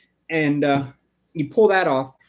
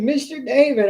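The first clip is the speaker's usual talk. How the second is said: imitating the old lady; 9 semitones higher, 4 dB louder than usual. Pest guy retelling the old lady's phone call, high-pitched. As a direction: mimic how the old customer calls his name. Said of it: "That's the way she says it".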